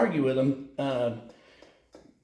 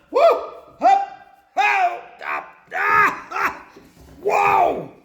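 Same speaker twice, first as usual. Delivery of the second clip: high loud yelps while climbing in; 4 dB louder, much slower than usual. Real, not acted. Climbing into a milk tank, real yelps. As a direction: yelp as you clamber over the edge.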